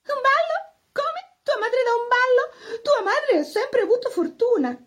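put-on high, strained voice of a jealous old teacher; a governess hiding her envy behind a high, forced tone; envious governess. Teacher to pupil, high and strained with envy. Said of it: "Si rode dalla rabbia".

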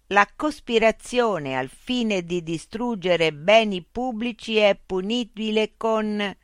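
high, loud, slow loudspeaker machine. Acted. Loudspeaker robot voice addressing Mitch. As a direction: speak as a polite, mechanical information unit.

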